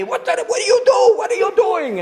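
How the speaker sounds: high, loud panicked shout; retold scream. People in the store screaming at him, high and loud. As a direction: bystanders yelling at him in alarm. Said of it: "are screaming at me".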